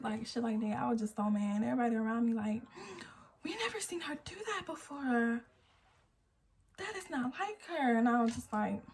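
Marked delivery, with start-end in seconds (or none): 3.4-8.0 high, hushed whisper of onlookers; whispered gossip, pitched up; bystanders whispering. Retold or imitated. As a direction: gossip it under your breath. Bystanders murmuring about her.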